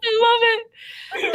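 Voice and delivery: high, slow amused delight; savoring the bad accent. Real, not acted. Reader enjoying her friend's British accent, delighted.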